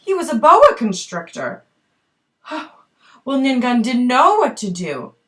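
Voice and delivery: usual speaking voice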